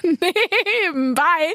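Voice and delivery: slowly, laughing through the words. Real, not acted. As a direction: lachen mitten im Satz. Laughing mid-sentence. (lachend, gedehnt)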